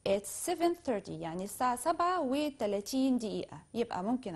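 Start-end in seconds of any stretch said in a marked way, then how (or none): 0.1-1.1 slow, lifted model of the time; slow demo. Shown as a demonstration. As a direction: say the time slowly and clearly. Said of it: "بطريقة أخرى". (قول الوقت ببطء)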